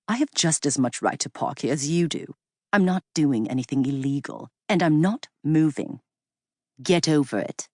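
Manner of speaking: lightly voiced English accent, condescending and cool; a hint of a patronizing Englishman. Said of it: "that same melodic English accent".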